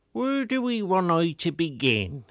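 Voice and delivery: put-on a proud old villager boasting of his garden; old Wiltshire countryman. A narrator's reading. Old gardener Collingbourne Tuck boasts to the reporter, slow rustic old voice.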